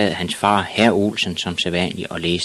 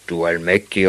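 mildly raised father voice; understated storybook outcry; appalled shout; 3 dB louder than usual. A narrator's reading. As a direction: exclaim it as a dumbfounded dad. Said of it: "råbte hans far".

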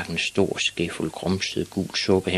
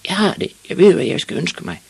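high, excited shout; screamed; 11 semitones higher, 6 dB louder than usual. A narrator's reading. Ivan running to find the old witch, shouting. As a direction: call out the great idea on the run. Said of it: "skreg han, mens han benede afsted".

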